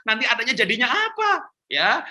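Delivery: ordinary talk, nothing marked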